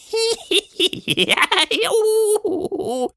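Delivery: high-pitched silly creature giggle trailing into a squeal. A narrator's reading. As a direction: giggle away in a squeaky monster voice. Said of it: "ein leises, albernes Giggeln".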